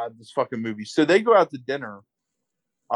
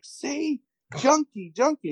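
mock accusation; slower than usual. Host as man who found more codeine, mock accusing. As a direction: imitate hysterical finger-pointing.

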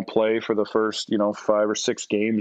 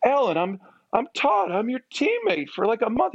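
pitched way up, hurt and flustered; squeaky indignant self; 13 semitones higher than usual. Retold or imitated. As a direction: squeak it out like a snubbed rookie. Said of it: "And I'm like".

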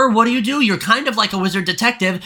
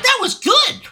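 very high airhead voice with fake giggles; parodying happy viewers gushing about Tina; mock moviegoer; 14 semitones higher, faster than usual. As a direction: ham up a clueless fan gushing. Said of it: "and were like".

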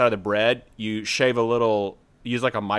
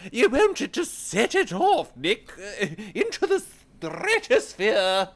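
much higher, slow silly voice; 10 semitones higher, slower than usual. Acted. Hyping balsamic jam to his co-host, in a soaring high voice. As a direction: let the voice soar way up. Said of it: "that was a good voice there".

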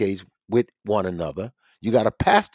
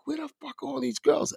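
amazed question; 5 dB quieter than usual. Retold or imitated. Stunned by crowd of women.

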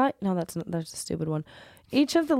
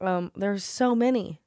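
ordinary talk, nothing marked

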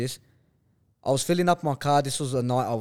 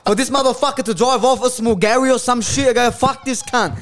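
defiant shout; 9 semitones higher, 7 dB louder than usual. Retold or imitated. Defiant retold answer to brother.

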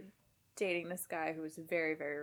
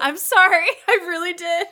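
laughing apology; 12 semitones higher, 18 dB louder than usual. Real, not acted.

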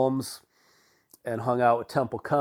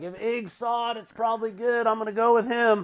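imitating Scott; 9 semitones higher than usual. Scott quoted, high voice.

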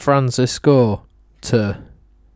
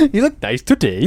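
higher Welsh lilt rising like a question; Welsh accent; 6 semitones higher than usual. Acted. Trying out Welsh for a friend, rising Welsh lilt. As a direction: put on a sing-song Welsh accent. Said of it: "that's the Welsh accent".

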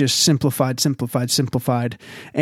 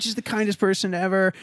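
usual speaking voice

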